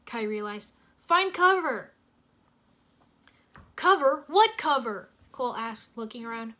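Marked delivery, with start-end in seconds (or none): 1.1-5.1 loud, higher urgent calls; the team yelling in alarm as the asteroids come; alarmed shouting. Acted. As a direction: shout in panic about the danger.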